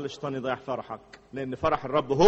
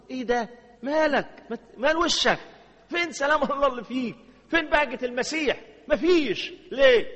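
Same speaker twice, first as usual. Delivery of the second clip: mock surprise; 8 semitones higher than usual. Preacher voices puzzled questioning of a gloomy man.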